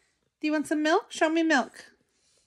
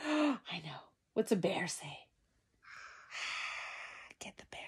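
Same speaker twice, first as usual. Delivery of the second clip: put-on hushed secret whisper to a toddler; soft breathy whisper to baby; playful whisper; 7 semitones lower, 17 dB quieter, faster than usual. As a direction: whisper it like a little secret game.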